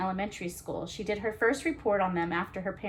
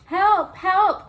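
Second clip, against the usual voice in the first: much higher, much louder frightened cry; crying for help; 12 semitones higher, 11 dB louder than usual. Acted. Trapped manatee Betsy calling for help, loud frightened cry. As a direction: yell it like you're trapped and scared. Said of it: "cried Betsy".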